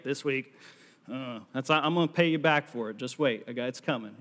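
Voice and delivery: ordinary talk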